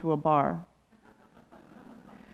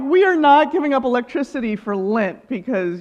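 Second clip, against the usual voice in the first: higher, louder mock stern mother; mock stern mom; 5 semitones higher, 5 dB louder than usual. Mother refusing the Lent plan, mock stern and high. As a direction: play the mother laying down the law, half-amused. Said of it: "my mom was like".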